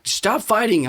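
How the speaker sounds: high-pitched annoyed parent outburst; blowing up. Acted. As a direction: act out snapping at squabbling kids. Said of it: "blow up and be like".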